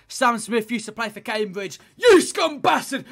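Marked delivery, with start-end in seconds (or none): 2.0-3.0 slow, high, loud hammed-up abuse; mock angry shout. Fan jeering a former rival's player, mock furious shout. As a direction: bellow it like a terrace insult.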